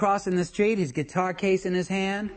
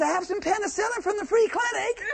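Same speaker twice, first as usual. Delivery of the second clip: Kimmy's voice; 12 semitones higher than usual. Retold or imitated. Ex calling Pete over from across the street, high coaxing voice. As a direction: pitch way up and coo at him. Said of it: "She says".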